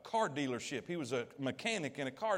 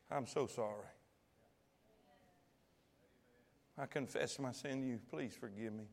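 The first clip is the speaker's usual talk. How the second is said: soft, lower, humble prayer to God; quiet confession; 5 semitones lower, 9 dB quieter than usual. Pretend. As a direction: confess it gently, almost under your breath.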